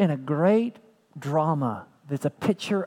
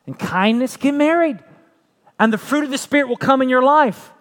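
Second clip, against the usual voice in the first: louder, higher preaching build; rising preaching; 5 semitones higher, 3 dB louder than usual. Acted. Preacher urging the congregation, building loud and high. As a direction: preach it up to the climax.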